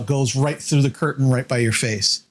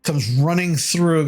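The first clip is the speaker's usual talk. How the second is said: normal talking voice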